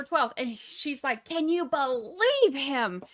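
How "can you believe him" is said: drawn-out, incredulous mock outrage; mock indignation. Infuriated mother griping about her son-in-law, mock indignant. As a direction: lean hard and slow on "believe". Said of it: "that infuriates Donna".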